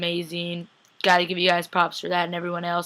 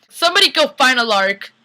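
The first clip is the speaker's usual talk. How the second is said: loud, raised call-out; mock urgent call; 5 semitones higher, 6 dB louder than usual. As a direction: call out to send people searching. Acted alarmed call for searchers.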